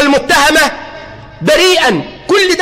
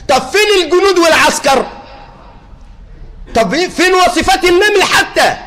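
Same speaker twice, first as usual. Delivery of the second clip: mock complaint; 5 semitones higher than usual. Imagined complaint about soldiers.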